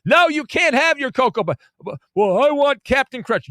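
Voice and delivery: put-on high, loud bratty child crying for cereal; whiny toddler tantrum. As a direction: wail it like a toddler on a grocery store floor. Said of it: "they're on the floor and they're crying".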